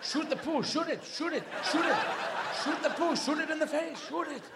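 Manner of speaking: high, insistent dog voice; excitable dog. Acted. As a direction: egg the owner on with yapped orders. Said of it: "as the character of the dog".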